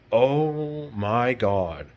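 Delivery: drawn-out slow gasp of disbelief; stunned oh my God. Retold or imitated. Retelling her lies, slow stunned oh my God. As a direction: let it stretch out in shock. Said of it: "I was like".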